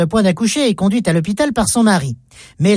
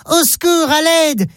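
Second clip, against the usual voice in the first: put-on a child shouting for help from a window, though not at full volume; child's cry for help; 6 semitones higher than usual. Child calling from the burning building, high-pitched cry for help. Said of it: "apparaît à la fenêtre ... et crie".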